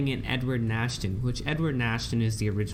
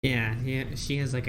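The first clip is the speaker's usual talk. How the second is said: ordinary delivery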